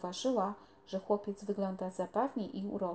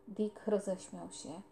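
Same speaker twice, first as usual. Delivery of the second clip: plain reading voice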